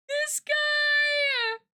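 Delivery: high squealing exclamation; delighted squeal. Real, not acted. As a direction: squeal at the cute animal. Squealing at capybara.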